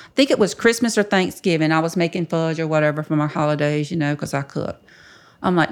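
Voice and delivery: ordinary delivery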